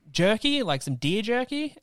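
high-pitched mocking offer; Chris Pratt. Actor offering deer jerky at the airport, mocked in high voice. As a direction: put on a high, over-friendly hunter voice. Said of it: "And he's".

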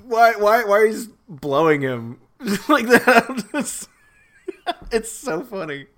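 laughing incredulous tease; laughing in disbelief. Real, not acted.

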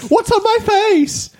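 squealed, drawn-out mock alarm; ditzy shop worker. Clerk pestering a customer who can't stop laughing, high slow squeal. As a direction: send it way up high and stretch it. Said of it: "she just keeps going".

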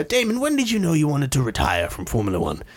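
put-on interviewer voice, swooping intonation; Michael Parkinson. Imitating interviewer asking retired driver, put-on voice. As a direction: pose it like a chat-show host. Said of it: "He goes, uh".

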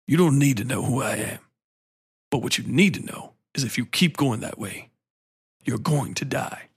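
buttery, measured ominous warning; Lance Reddick. Acted. Mysterious man warns the heroine of death, smooth measured imitation. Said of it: "his voice is so fucking buttery".

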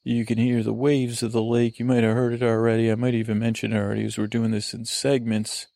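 ordinary delivery

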